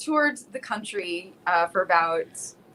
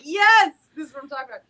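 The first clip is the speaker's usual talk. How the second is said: louder, higher, quick burst of approval; delighted cheer; 5 semitones higher, 6 dB louder, faster than usual. Retold or imitated. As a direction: re-enact loving it, punching out the yes. Acted delight at being ignored.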